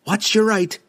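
sharp high warning cry. A narrator's reading. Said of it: "Canterbury cried out".

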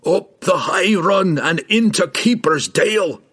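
high, slow delirious cry; screamed directions. A narrator's reading. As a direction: call it out desperately, drawing out the words. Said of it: "Bruner screamed back".

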